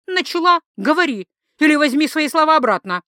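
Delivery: high, loud ultimatum; heated demand. Acted. Wife demands rival retract her words, heated and loud.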